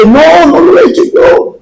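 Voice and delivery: negación aguda, imitando a una niña; protesta infantil. Retold or imitated. Niña acusada se defiende ante padre. (protesta de niña, imitada)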